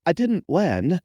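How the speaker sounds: put-on high, affected girlish cadence; hammed-up high voice, every phrase affected; super affected voice. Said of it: "super affected voice".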